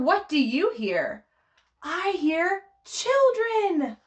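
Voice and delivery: slow, sing-song picture book reading; reading to children. Acted. Teacher reading Polar Bear aloud to a baby class, sing-song. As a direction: read it to the babies in a lilting rhythm.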